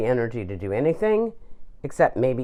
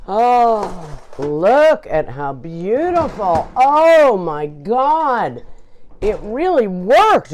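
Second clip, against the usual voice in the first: amazed delight; 5 semitones higher, 5 dB louder, slower than usual. Real, not acted. Amazed at finished dish.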